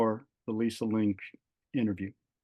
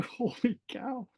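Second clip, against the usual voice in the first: stunned surprise; 12 semitones higher, 4 dB quieter, slower than usual. Retold or imitated. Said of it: "my jaw just dropped".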